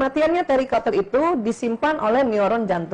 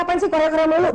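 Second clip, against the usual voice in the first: higher-pitched mock scolding; imitated scolding mother; 7 semitones higher, faster than usual. Mother nags child about Korean stuff, mock scolding. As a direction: play an exasperated mum telling off a child. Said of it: "Banyak ibu-ibu marahin anaknya".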